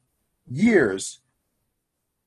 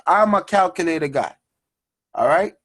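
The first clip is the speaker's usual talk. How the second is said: usual speaking voice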